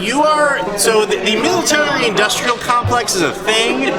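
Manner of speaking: normal talking voice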